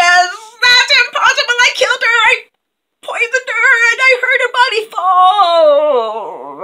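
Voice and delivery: high, loud villainess shriek; Silver Tree. Acted. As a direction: screech in outraged disbelief, drawing out the end. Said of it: "And Silver Tree says".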